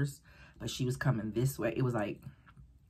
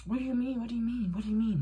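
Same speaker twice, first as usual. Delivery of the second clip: higher, urgent repeats; alarmed questioning; 9 semitones higher, faster than usual. Retold or imitated. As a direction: press her, growing alarmed. Pressing friend anxiously.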